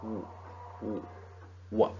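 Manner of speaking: w, w, wa: the O of "one" sounded as a w glide opening into wa; sounding out wa. Shown as a demonstration. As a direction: break the O of one down to w then wa. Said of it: "pronounced as wa".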